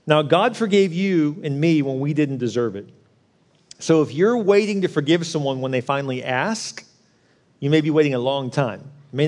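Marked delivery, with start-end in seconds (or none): none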